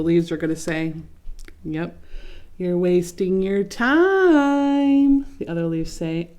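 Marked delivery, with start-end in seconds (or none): 2.6-5.2 higher, louder and very slow mocking singsong; taunting. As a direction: drag out the words to rub it in. Leaves taunting Leaf.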